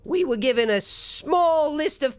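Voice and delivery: high, curt little gnome refusing entry; gnome guard. Acted. Gnome guard blocking the door, high and dismissive. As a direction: play a small gatekeeper turning them away. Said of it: "The gnome says".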